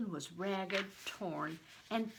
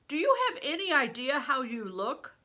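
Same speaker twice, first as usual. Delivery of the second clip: big stranger; 6 semitones higher, 7 dB louder than usual. A narrator's reading. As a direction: play the huge man, loud and hearty.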